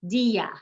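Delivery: "Dia" as two syllables, DEE-a; emphasized I. Shown as a demonstration. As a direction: stress the I of "Dia" and keep the A separate. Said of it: "we've emphasized the I".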